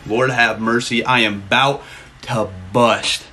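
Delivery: slow, low and deliberate; drawn-out catchphrase. Real, not acted. Streamer repeats the catchphrase to chat, slow and drawn out. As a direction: stretch each word out, especially "about to bust".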